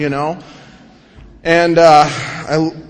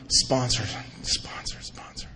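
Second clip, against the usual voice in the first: hushed, fading whisper; whispered realization; 6 semitones lower, 13 dB quieter, slower than usual. Retold or imitated. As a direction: whisper it to yourself as the idea dawns. Dawning scheme about sponsor.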